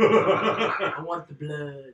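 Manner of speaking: low, slow, dragged-out craving; bloodthirsty growl. Acted. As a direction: drawl it like a hungry vampire. Mock bloodthirsty voice.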